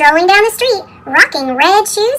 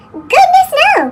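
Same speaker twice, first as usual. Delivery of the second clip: excited; 10 semitones higher than usual. A narrator's reading. Cheerful answer to the question.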